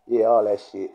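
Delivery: ordinary talk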